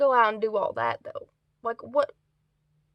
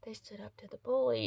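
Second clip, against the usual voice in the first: awed whisper; 7 dB quieter than usual. Retold or imitated. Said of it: "I was like".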